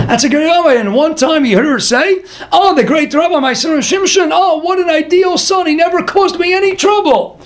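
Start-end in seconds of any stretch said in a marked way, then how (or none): 2.5-7.4 high-pitched imitation of a proud mother; gushing praise. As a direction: gush about an ideal son, pitched way up.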